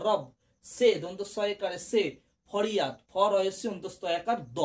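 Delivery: normal talking voice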